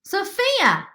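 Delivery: loud, high urgent call; urgent shout of a name. A narrator's reading. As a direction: call her name across the room. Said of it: "she called".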